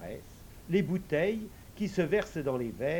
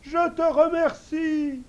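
much higher, louder, touched invisible servant; moved servant voice; 13 semitones higher, 9 dB louder, slower than usual. Acted. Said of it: "dit la voix tout émue".

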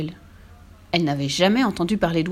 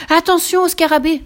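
high, loud urgent shout; cried warning; 10 semitones higher, 8 dB louder than usual. A narrator's reading. Fifi warns Tommy off the beetle, urgent high shout. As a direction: shout a sudden warning, voice pitched right up. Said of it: "s'écria Fifi".

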